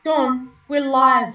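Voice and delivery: loud, slow call. Real, not acted. Calling to Dawn in chat, loud and drawn-out. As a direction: call out to her across the stream. Calling out to viewer mid-stream.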